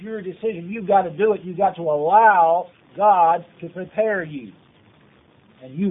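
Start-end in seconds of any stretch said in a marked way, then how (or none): 1.4-4.5 "allow" long and emphatic; slow down and press on "allow"; stress on allow. Real, not acted. Preacher telling listeners to let God prepare them, stressing "allow". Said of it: "I emphasize the word allow".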